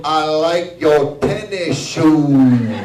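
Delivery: slow, loud, drawn-out compliment; slow repeat. Retold or imitated. The stranger repeating his compliment, slow and loud. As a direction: spell it out slowly and loudly.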